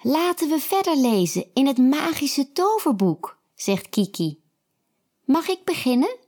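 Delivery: high eager voice; eager girl. A narrator's reading. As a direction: ask brightly, raring to go. Kiki eager to read.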